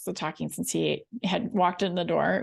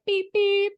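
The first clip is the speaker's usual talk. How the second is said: high, light, dainty "Beep, beep"; cute tiny car horn; polite horn beep; 11 semitones higher, 4 dB louder than usual. Retold or imitated. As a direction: mimic a polite little tap of the horn. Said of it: "it wasn't, like, a courtesy".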